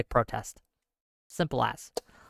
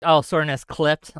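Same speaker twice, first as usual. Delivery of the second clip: ordinary delivery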